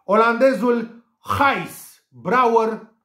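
usual speaking voice

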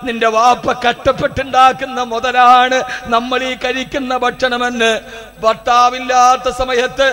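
loud, high sermon at full force; fiery preaching. Acted. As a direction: preach it out with burning passion.

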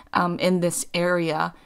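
normal talking voice

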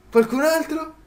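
high, taunting challenge to the next foe; cocky taunt. Real, not acted. Gamer after beating coyotes, high cocky taunt. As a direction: dare anyone else to come.

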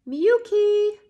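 high, loud call across the room. A narrator's reading. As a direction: call her name out. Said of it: "the prime minister called for his daughter".

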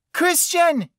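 very high, loud tearful call. A narrator's reading. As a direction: shout his name down the hallway. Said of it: "she called out".

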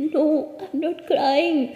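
drawn-out, choked denial through tears; tearful denial. A narrator's reading. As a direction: deny crying while crying. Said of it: "अनोखी रोते हुए आवाज़ में बोलती". (रोती आवाज़ में इनकार)

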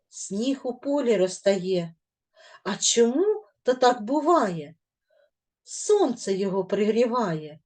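expressive reading of a spring poem. Shown as a demonstration. Teacher reading a spring poem to pupils, expressive. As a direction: read it like a question-and-answer verse.

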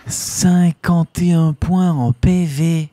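drawn out and loud, savouring every word; slow, loud, stunned stat reveal; slow amazed announcement. Real, not acted. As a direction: announce it like a jackpot win. Reads huge stat aloud.

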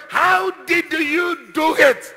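high, loud, slow demand; acted incredulous holler; woman's shout. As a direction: shout it out word by word. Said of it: "The woman shouted".

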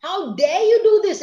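high, loud outraged demand; angry. Shown as a demonstration. Teacher acting out anger for her class, high and loud. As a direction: let the anger push the pitch way up. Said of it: "When I'm very angry, my pitch is very high".

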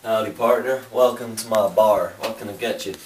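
friendly American Southern bartender voice, lightly put on; mock Southern accent. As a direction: greet a stranger like an old-time saloon keeper in a Southern accent. Cowboy bartender role.

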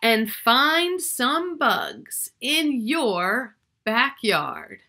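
slow storybook ending, full of wonder; reading to children. Acted. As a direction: draw the children in, slowly. Reads the book's closing lines.

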